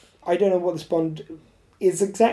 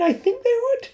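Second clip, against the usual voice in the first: much higher, straining up the register; playful squeaky pitch; mock high voice. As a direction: climb up into a silly high voice. Pushing voice up very high.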